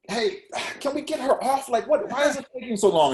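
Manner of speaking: high-pitched imitation of an impatient older woman; frustrated complaint. Impatient poet at a party wants the singer off, frustrated. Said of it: "she was getting frustrated".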